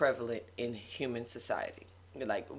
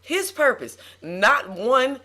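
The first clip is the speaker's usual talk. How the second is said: loud, high, emphatic preacher delivery; sermon voice rising in force; preaching; 4 semitones higher, 8 dB louder than usual. Real, not acted. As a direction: preach it in forceful, punched phrases. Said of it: "You preaching that thing".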